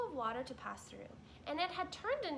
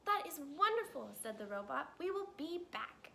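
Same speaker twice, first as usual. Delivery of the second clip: higher robot voice; robot Roz pleased; 5 semitones higher than usual. Acted. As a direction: answer gladly as the robot. Roz replies.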